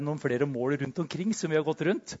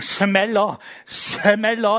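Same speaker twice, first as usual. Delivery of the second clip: impatient urging; 4 semitones higher, 6 dB louder, much slower than usual. Retold or imitated. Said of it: "Jeg bare tenkte".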